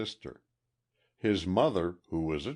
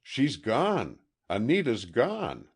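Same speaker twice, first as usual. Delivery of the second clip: raised, quivering cry of alarm; 7 semitones higher, 5 dB louder than usual. A narrator's reading. Distraught fiancé telling the detective she is gone, voice quivering. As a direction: voice trembling with panic. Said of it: "his voice quivered as he said".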